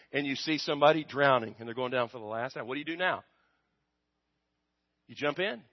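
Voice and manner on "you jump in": loud, high preaching of the verse; full-force preaching. Acted. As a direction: preach it, building to the top. Preaching the rescue story.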